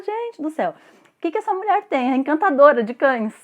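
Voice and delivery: high, loud, delighted exclamation; amazed gushing. Retold or imitated. Client marvelling at the vet with the dogs, high excited gush. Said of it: "você olha e você fala".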